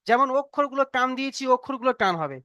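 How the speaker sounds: ordinary delivery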